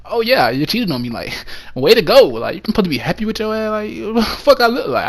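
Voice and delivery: mock-cheerful, swinging up in pitch; exaggerated approval turning indignant; sarcastic congratulations. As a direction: feign being happy for the cheater. Mock happy reply to being cheated on.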